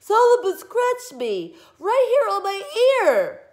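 high, loud crybaby wail; shrieking. A narrator's reading. Girl accusing the cat, shrieking. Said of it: "she shrieked".

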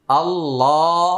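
আল্লাহ stretched long with a heavy full l; drawn-out Allah. Shown as a demonstration. Teacher spelling out Allah for students, stretched slowly. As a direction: sound out আল্লাহ slowly, lam held thick. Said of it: "হামজা লাম জবর".

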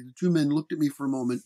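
usual speaking voice

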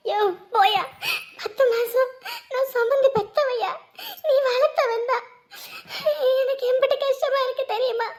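loud, sobbing protest; tearful outburst. Acted. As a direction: push him aside through angry tears. Distraught mother quarrels to see son.

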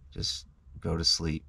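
ordinary delivery

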